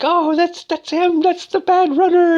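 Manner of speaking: very high, loud hysterical accusation; mock-delirious falsetto cry; delirious woman. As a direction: imitate a woman shrieking an accusation. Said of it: "she's all delirious".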